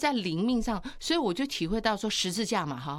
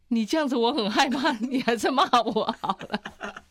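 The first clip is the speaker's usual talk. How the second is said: mock scared husband; 3 semitones higher, 6 dB louder than usual. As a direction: beg to be scolded, half joking. Husband asks to be scolded.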